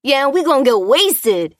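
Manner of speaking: high, loud party-goer voice; rowdy interruption. A narrator's reading. Second reveller interrupting the interview, rowdy. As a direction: butt in whooping like a reveller. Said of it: "interrupted another".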